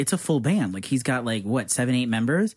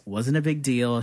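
ordinary delivery

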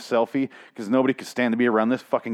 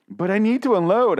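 raised pitch for a mock teenager; teenage son; 8 semitones higher than usual. Son complains to his father at dinner, mock teenage voice. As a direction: plead in a higher, put-on kid's voice. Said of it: "our son said".

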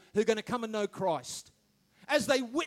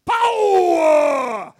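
loud drawn-out shouted power; Pentecostal preacher shout; 10 dB louder, much slower than usual. Retold or imitated. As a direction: belt it like a revival preacher. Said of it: "how I used to hear it when I go to church".

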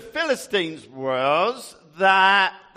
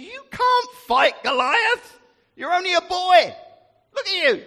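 King Saul; 9 semitones higher than usual. Acted. Saul voiced telling David off.

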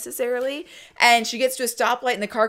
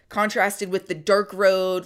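usual speaking voice

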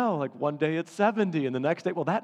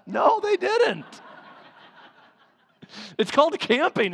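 high, loud laughing protest; laughing denial; 11 semitones higher, 3 dB louder than usual. Real, not acted. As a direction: laugh it off, pitched up. Laughing denial of wife's claim.

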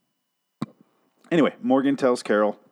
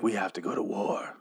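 soft whispered mock declaration; hushed imitation of a grim fighter; husky whisper; 2 semitones lower, 9 dB quieter than usual. Imitates Morgan reporting to Carol.

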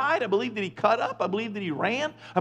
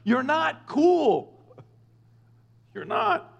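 slow, higher put-down on cool; mock scolding; 8 semitones higher, much slower than usual. Real, not acted. Telling swearing churchgoers off, a slow higher put-down. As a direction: drag out cool to cut them down.

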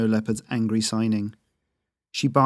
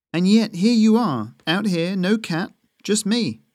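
higher, louder teasing jab; mocking; 8 semitones higher, 4 dB louder than usual. A narrator's reading. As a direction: needle him with a mocking lilt. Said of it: "Yuff said mockingly".

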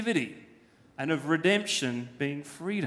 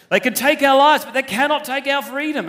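loud, high Braveheart rallying cry in a Scottish accent; rally the troops like Wallace, Scottish accent; 6 semitones higher, 8 dB louder than usual. Shown as a demonstration. Preacher does Wallace at Stirling Bridge, loud Scottish accent. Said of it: "Sounds much better when it's in a Scottish accent".

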